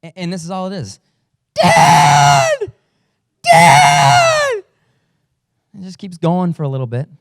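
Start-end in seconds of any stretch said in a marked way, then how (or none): 1.5-4.6 high piercing yell of Dad, much louder; kid's panicked shout, loud and high; retold scream for dad. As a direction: call Dad like a terrified kid screaming. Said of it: "this blood-curdling scream".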